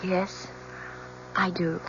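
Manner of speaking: faint, timid yes; soft, timid reply in court; barely audible. Acted. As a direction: answer so quietly the judge can't hear. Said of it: "Please speak up".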